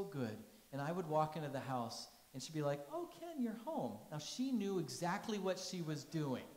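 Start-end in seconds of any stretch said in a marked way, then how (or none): 2.9-4.0 high sing-song fake surprise; mock surprise. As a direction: act startled to see him. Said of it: "she would act surprised".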